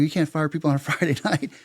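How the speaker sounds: high-pitched, amused disbelief; protesting. Retold or imitated. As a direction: object as if it's absurd. Retells protesting firings to dad.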